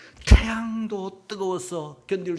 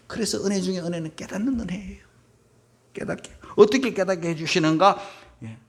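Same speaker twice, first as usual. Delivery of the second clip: ordinary talk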